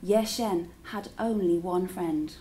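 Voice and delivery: ordinary delivery, nothing marked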